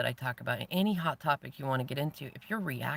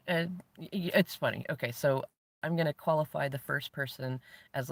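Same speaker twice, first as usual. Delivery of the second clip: ordinary delivery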